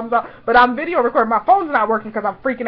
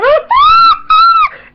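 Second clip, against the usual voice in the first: loud, high squealing laugh; piercing fangirl squeal; excited shriek; 12 dB louder than usual. Retold or imitated. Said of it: "y'all can hear me in the video".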